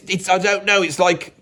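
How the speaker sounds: normal talking voice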